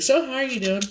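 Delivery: high sing-song doctor voice; doctor's voice. Retold or imitated. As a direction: mimic the doctor's sweet question. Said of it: "he'd be like".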